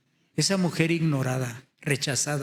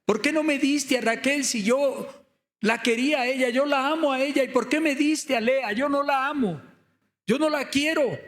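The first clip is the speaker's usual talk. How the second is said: high-pitched pleading protest; imitated indignant complaint; acted angry reproach; 8 semitones higher than usual. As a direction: protest bitterly in the husband's role, pitched well up. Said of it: "reclamando en su contra".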